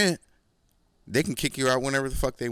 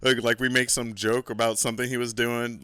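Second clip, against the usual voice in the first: ordinary delivery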